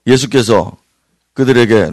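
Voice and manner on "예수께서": ordinary talk, nothing marked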